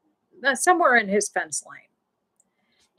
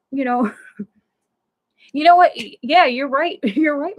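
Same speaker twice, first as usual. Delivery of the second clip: laughing while conceding, a bit louder; 5 semitones higher, 6 dB louder than usual. Real, not acted. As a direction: laugh it off and agree. Laughing reply to Michelle.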